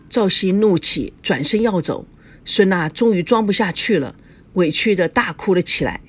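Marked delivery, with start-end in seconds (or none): none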